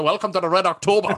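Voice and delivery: put-on a quick, playful nod to Connery's Scottish accent; Sean Connery's Scottish accent. Joking about Connery never losing his Scottish accent, with a light mock Scottish burr. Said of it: "he was a".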